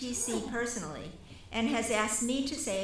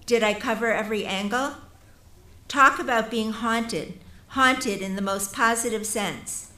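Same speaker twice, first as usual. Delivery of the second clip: building from measured reading to a louder, impassioned address; rising formal oration; 7 dB louder than usual. Acted. Reading the absent honoree's speech to the audience, growing louder and impassioned. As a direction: swell into a stirring tribute, leaning on each beyond.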